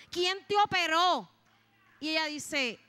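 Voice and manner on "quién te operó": loud, high, drawn-out startled question; startled outcry. Retold or imitated. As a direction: demand it again in astonishment, at full voice. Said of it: "quitó la máquina asustada y me dice".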